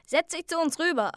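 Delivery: lightly voiced boy calling over; friend inviting. A narrator's reading. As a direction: wave him over eagerly. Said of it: "sagte Zayden".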